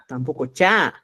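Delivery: normal talking voice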